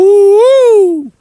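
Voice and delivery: sudden high, loud "Ooh" of mock amazement; a sharp whooping ooh, impressed and scornful. Real, not acted. Preacher reacting to a political clip, loud high ooh.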